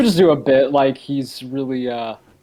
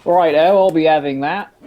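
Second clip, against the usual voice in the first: put-on loud, pitched-up British accent; mock British accent; 3 semitones higher, 7 dB louder than usual. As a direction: do a hammed-up British bloke. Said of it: "There goes our U K audience".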